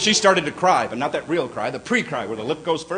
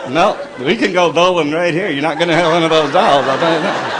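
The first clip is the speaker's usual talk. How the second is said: smiley ventriloquism; 5 dB louder than usual. Retold or imitated. Husband refusing his wife through a fixed smile. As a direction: speak through a fixed smile, lips barely moving. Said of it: "universal male smiley ventriloquism act".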